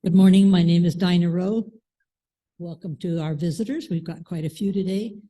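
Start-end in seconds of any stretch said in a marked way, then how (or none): none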